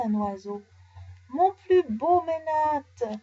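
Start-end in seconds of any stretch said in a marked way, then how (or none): 1.3-3.2 high, slow, loud wail of loss; lamenting. Acted. Merchant laments his finest mynah flying away, wailing. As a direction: wail for the lost bird. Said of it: "se lamente le marchand".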